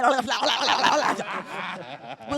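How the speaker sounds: loud, babbling tongue-tangle; garbled Spanish blooper. Retold or imitated. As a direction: tumble loudly over the word in nonsense syllables.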